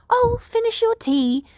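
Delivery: high, slow fussing host voice; high insistent host; insistent Nedzu. A narrator's reading. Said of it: "Nedzu insists".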